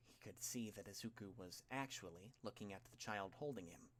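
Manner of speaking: plain reading voice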